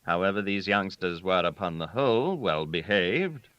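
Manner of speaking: ordinary delivery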